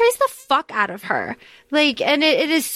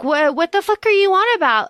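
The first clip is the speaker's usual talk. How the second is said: angry outburst; 7 semitones higher than usual. Retold or imitated. Himina retold snapping at her friend, mock angry. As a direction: throw it back at her friend, indignant. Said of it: "Himina gets so angry".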